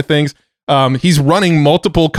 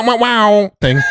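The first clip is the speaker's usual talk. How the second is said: higher voiced wow-wow effect; wow sound effect; 7 semitones higher than usual. Shown as a demonstration. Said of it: "I just need a wow".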